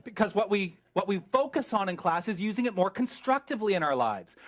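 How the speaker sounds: normal talking voice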